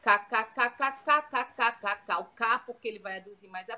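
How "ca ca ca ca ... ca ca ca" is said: evenly repeated staccato ka syllables; vocal drill on repeated ka; ka-ka exercise. Shown as a demonstration. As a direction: tap out a string of ka's. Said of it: "eu faço exercício com K K K".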